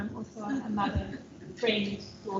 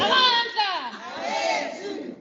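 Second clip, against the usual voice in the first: rallying cry; 10 semitones higher, slower than usual. Real, not acted. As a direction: shout the slogan out to rally everyone. Repeats the rally call.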